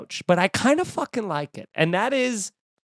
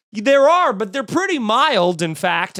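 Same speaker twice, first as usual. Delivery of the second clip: mock ad actor; 5 semitones higher, 5 dB louder than usual. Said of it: "And they go".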